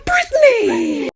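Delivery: high slow shout with a laugh; high loud slow laughing call. Pretend. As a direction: yell the name laughing.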